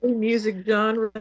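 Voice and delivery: choppy voice cutting in and out; bad connection, words dropping out; radio breaking up. Real, not acted. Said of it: "You breaking up, [name]".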